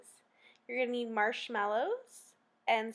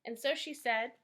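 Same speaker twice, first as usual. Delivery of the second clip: ordinary delivery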